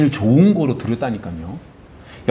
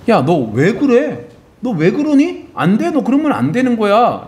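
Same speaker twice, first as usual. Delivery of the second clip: much higher, louder reproach, slow at first; a father warning a stubborn son; God rebuking Cain; 8 semitones higher, 3 dB louder than usual. Acted. God rebuking Cain, acted quote.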